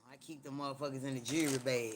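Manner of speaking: ordinary talk, nothing marked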